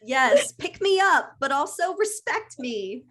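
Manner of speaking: put-on high-pitched play toddler demand; mock demanding baby. Friend extending the baby-wanting-power bit, mock childish demand. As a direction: plead and insist like a small child.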